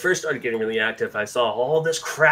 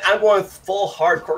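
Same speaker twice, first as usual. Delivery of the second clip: emphatic; 8 semitones higher, 7 dB louder, slower than usual. Real, not acted. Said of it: "emphatic announcement".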